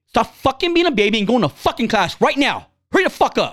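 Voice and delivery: retold angry dad's outburst, high and forceful; heated. Dad ordering his son into church class, heated. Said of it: "and I was heated".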